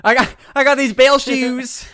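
high, loud southern twang; hammed-up Texas accent; Phantom Bell man. Ghost bellman showing off his bell shoes, in a southern accent. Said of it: "He speaks like a southern debonair".

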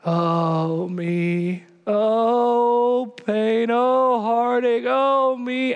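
lightly hammed-up moaning sighs; mock the self-pitying complainer; mock whining. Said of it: "tired of seeing people over and over again".

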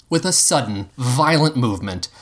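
ordinary delivery, nothing marked

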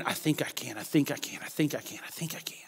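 murmured chant fading into a whisper; fading self-talk mantra. Acted. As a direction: repeat it under your breath, dying away.

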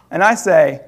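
ordinary talk, nothing marked

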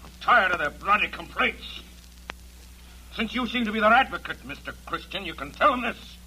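angry. Acted.

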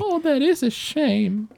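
put-on high, slow elderly woman; a polite old lady sympathizing. Old woman replying to Alice, high slow voice.

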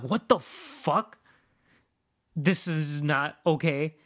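drawn-out, slow exasperation; slow disbelief. Retold or imitated. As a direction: let each word sink in. Stunned at the Uber map.

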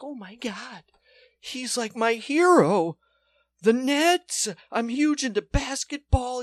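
high, gushing fan voice; starstruck fan. Retold or imitated. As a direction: squeal it like a starstruck kid meeting his idol. Fan gushing about his hero.

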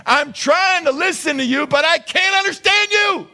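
pleading high voice of someone who can't make out the words; exasperated God. Retold or imitated. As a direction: play God throwing up his hands at a garbled prayer. God voiced straining to hear.

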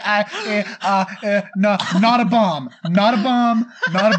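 mock panicked, rising pleading; panicked stammer. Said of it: "starts having a mild panic attack".

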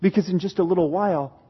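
normal talking voice

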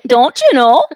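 high, loud cartoon mom voice; cartoon mom impression, high and loud; Bobby's World mom. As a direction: belt it like a cartoon mother. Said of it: "I can do the mom from Bobby's World".

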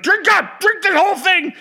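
high, loud bossy command; dad yelling. Retold or imitated. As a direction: shout it like an impatient father. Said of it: "my dad would be like".

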